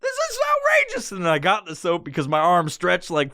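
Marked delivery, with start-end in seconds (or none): 0.0-1.1 very high, fast, incredulous squeal; squeaky disbelief. Retold or imitated. Stoned in the shower reaching for soap, high squeal. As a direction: shriek at the giant shower. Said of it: "I was like".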